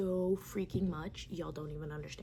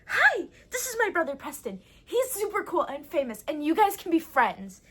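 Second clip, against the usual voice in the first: put-on high, loud childish chirp; high kid voice gushing; little sister voice; 6 semitones higher, 8 dB louder than usual. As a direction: play an eager little girl. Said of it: "and she's like".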